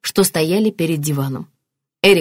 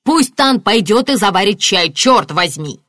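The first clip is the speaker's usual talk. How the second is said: loud, heated rebuke; barking angrily; 4 semitones higher, 5 dB louder than usual. A narrator's reading. Martin scolding his wife about their son, barking. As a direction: press on angrily, voice raised. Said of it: "рявкнул Мартин".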